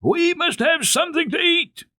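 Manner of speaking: high, loud yell down the mountain; screaming with all his might. A narrator's reading. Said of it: "screamed down with all his might".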